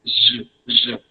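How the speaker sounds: "J, j" as a soft French consonant; soft G sound. Shown as a demonstration. Teacher repeats French G's sound, soft G sound. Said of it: "like that".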